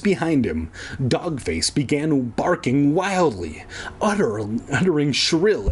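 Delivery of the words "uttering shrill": ordinary delivery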